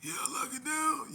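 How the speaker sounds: high, strained mimicry; imitated big man's voice. Imitating the big swole dude's order, high put-on voice. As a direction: put on a squeezed, high-pitched voice. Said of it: "that talked with the".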